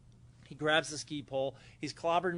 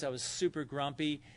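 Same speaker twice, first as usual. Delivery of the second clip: ordinary delivery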